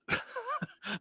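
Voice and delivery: short high-pitched laugh; a quick squeaky giggle. Real, not acted. Laughs at own misreading.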